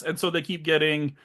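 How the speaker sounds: usual speaking voice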